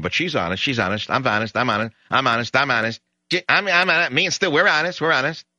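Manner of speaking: mocking, rising into a high sing-song of I'm honest; sarcastic. Host mocking politicians' honesty claims, sarcastic sing-song. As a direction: needle them with fake innocence.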